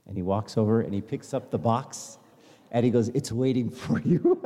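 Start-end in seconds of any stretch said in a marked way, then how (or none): 3.1-4.4 slow line broken by laughter as the shopkeeper; laughing shopkeeper line. Retold or imitated. Shopkeeper finally offering the box he kept, laughing. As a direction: let the laugh spill into the shopkeeper's words.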